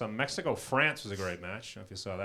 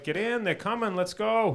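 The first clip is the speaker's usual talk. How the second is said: higher-pitched hurry-up call; acted-out urgent getaway plea; urgent urging; 7 semitones higher than usual. Dad hurrying his son into the getaway car, urgent.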